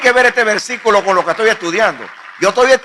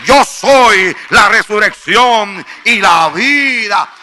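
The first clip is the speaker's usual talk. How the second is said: loud, driving sermon climax; fiery pulpit preaching; preaching at full force; 5 dB louder than usual. Acted. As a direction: preach it hard to the crowd.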